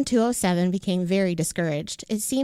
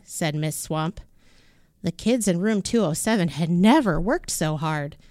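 ordinary delivery, nothing marked

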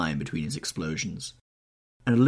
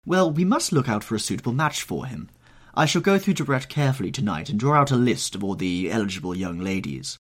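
higher, louder society matron; Lady Narborough voice; 4 semitones higher, 4 dB louder than usual. Acted. Old hostess fussing over Dorian, higher and louder.

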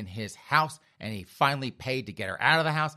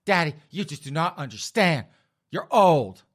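loud, high bratty outburst at Dad; indignant daughter; 5 semitones higher, 8 dB louder than usual. Retold or imitated. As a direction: play an exasperated daughter, building louder to the last word. Acted father and daughter quarrel.

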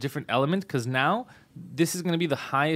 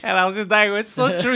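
normal talking voice

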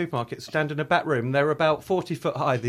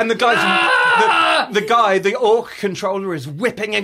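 much higher, louder mock yell; hammed-up shouting; 6 semitones higher, 10 dB louder, slower than usual. Describing the goblin back room, hammed-up shouting. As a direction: yell it over the clanking chains.

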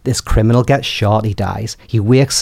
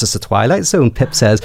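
ordinary talk, nothing marked

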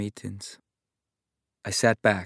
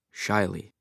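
ordinary delivery

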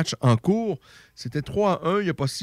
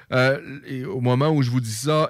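ordinary talk

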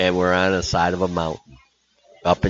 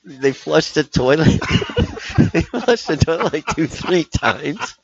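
laughing; 9 semitones higher than usual. Real, not acted. Laughing through the punchline.

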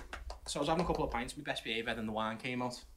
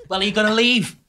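annoyed woman; 8 semitones higher, 11 dB louder than usual. Retold or imitated. Woman confronting him, retold.

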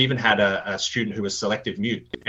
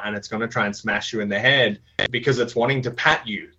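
ordinary talk, nothing marked

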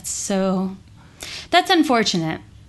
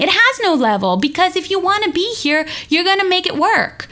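emphatic reply; 6 semitones higher than usual. Retold or imitated. Telling students her class has no level, fired up. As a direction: push back with fired-up conviction.

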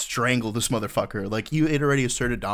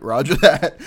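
loud, higher, laughing through it; laughing roger; 6 semitones higher, 11 dB louder, slower than usual. Retold or imitated. Friends answer the bartender, laughing loudly. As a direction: blurt it out while cracking up. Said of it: "we're like".

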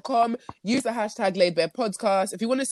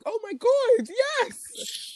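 excited; much slower than usual. Retold or imitated. Acts her relieved reaction.

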